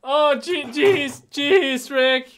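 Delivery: very loud high cartoon fretting; hammed-up nervous sidekick; Morty. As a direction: shout each word in a high-pitched fluster. Imitating Morty to Rick.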